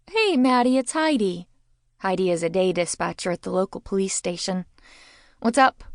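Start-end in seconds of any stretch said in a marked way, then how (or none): 0.1-1.5 bright, raised phone greeting; cheerful caller. A narrator's reading. 5.4-5.8 raised phone question. A narrator's reading. Answering the phone.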